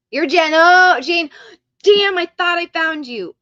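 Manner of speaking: loud, high, overjoyed outburst; really excited. Real, not acted. Streamer thinking she found the shoe winner, excited burst. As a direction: blurt it out like you cracked the case. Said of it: "Sorry that I got really excited".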